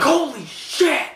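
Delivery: high, loud, slow exclamation; mock awed crowd. Crowd at the barricade crash, hammed-up shocked yell. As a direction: stretch it out like an astonished fan. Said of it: "They're like".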